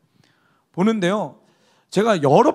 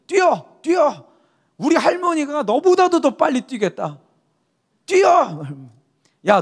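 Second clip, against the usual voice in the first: drill instructor yelling; 4 semitones higher than usual. Retold or imitated. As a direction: bark it like a drill sergeant. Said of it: "계속 욕하고 뭐라 그러냐면은". (조교가 훈련병에게 고함치는 흉내)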